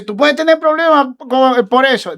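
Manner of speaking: a hint of panicky pleading; desperate warning. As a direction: plead anxiously, imitating him. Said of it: "desesperado me dice".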